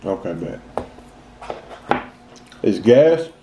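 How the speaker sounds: talking with mouth full; words between chews; chewing. Real, not acted. Seen 4: Bites cookie at -5.0; closed-mouth chewing through the labelled words.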